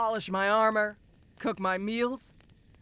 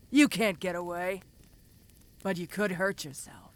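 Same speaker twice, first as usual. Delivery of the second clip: ordinary talk, nothing marked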